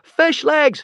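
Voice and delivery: high, loud desperate yell. A narrator's reading. Hiccup yelling to Fishlegs on burning boat, desperate shout. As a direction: call out to him in panic. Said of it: "shouted Hiccup".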